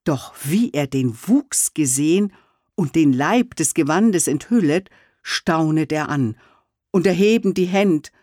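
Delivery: plain reading voice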